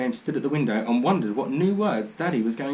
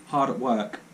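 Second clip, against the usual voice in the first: ordinary delivery, nothing marked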